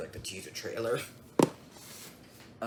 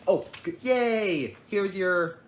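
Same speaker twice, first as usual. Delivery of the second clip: sarcastic cheer; 8 semitones higher, 6 dB louder, slower than usual. Pretend. Mock cheer at expired code.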